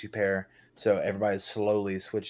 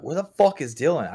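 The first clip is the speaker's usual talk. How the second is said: retold yell; 7 semitones higher, 8 dB louder than usual. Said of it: "And I'm like".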